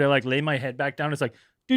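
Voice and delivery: usual speaking voice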